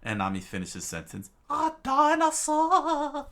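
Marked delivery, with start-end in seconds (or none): 1.5-3.3 very high, loud, drawn-out wail; Nami's terrified cry. A narrator's reading. Nami finishes Usopp's sentence, terrified shriek. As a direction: stretch it into a falsetto shriek.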